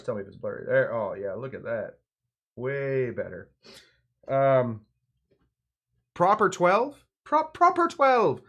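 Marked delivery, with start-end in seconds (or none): 6.1-8.4 high, loud mock Irish accent; hammed-up Irish accent, pitched high; put-on Irish accent. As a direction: draw it out in a playful Irish accent. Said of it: "he's enjoying the accent".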